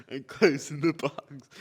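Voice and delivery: chuckling while talking; laughing. Real, not acted. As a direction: laugh breaking into words. Joking about boring job.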